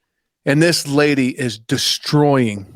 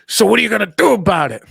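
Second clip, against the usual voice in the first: higher, fast demand; hammed-up irate customer; mock angry challenge; 6 semitones higher, faster than usual. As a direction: imitate a confrontational caller, quick and higher. Retold angry caller challenging him.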